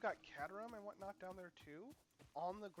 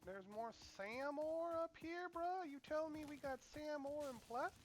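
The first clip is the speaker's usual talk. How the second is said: amazed disbelief; 9 semitones higher than usual. Real, not acted. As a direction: gasp it out in disbelief. Finding rare ore.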